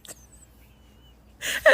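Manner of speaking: breathless, soft disbelief; barely voiced shock; stunned whisper. Boss stunned by the employee's word and whispering her reaction.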